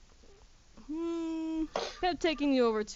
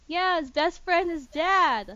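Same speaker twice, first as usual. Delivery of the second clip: put-on higher, slow and earnest child voice; little girl Maya; 7 semitones higher, 7 dB louder, slower than usual. Little Maya tells Darius about her plush dog, higher slow child voice. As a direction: say it simply like a small proud kid. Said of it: "And then Maya's like".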